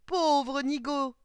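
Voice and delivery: loud, high, drawn-out jeer; mocking taunt. A narrator's reading. Girl hands on hips to fooled suitor, loud mocking taunt. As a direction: stretch the insult out with relish.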